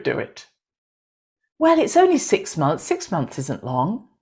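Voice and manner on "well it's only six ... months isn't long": persuading left brain. Acted. Left brain arguing with the heart, lightly acted coaxing. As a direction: play the inner voice that talks you into it. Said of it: "your left brain comes up with all the reasons".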